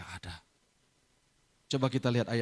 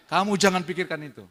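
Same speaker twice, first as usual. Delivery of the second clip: loud, higher fatherly insistence; firm insistence; 4 semitones higher, 8 dB louder than usual. Retold or imitated. Father insisting his daughter not worry, loud and firm. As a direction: press the reassurance home forcefully.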